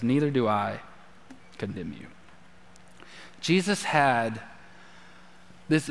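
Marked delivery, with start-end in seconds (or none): 0.0-2.1 much softer and lower, gentle; soft tender quote. Acted. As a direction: let it fall quietly like mercy. Preacher quotes Jesus softly.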